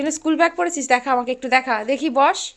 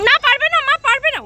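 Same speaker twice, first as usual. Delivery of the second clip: excited; 11 semitones higher, 8 dB louder than usual. Real, not acted. Parent excitedly playing with toddler.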